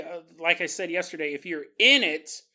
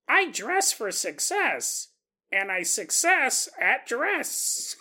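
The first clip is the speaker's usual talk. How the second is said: higher, very slow doll voice; talking doll; 4 semitones higher, much slower than usual. Pretend. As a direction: drag it out like a creepy toy. Doll heard at night.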